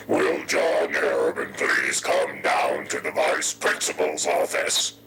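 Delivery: slow, drawn-out PA summons; imitating a vice principal on the PA; loudspeaker announcement. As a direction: announce it as if over a school loudspeaker. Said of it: "says over the loudspeaker".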